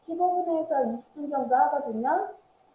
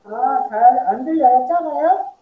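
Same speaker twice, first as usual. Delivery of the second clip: louder for a bad line; to someone hard of hearing; 8 dB louder than usual. Real, not acted. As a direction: speak up so they hear. Caller says sound too quiet.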